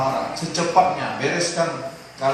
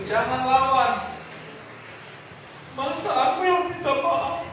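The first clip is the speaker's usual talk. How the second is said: higher, indignant protest; proud stubborn man; 10 semitones higher, 7 dB louder than usual. Acted. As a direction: play the offended proud husband, defending his pride. Voicing a proud offender. (orang sombong yang tersinggung, suara lebih tinggi)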